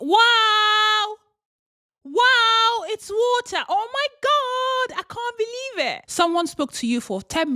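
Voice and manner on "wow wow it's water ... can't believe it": high, amazed gasping at plain water; awed wonder. Pretend. Speaker marvelling at water on a walk, high awed voice. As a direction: gush over water like a miracle. Thinks aloud in awe at water.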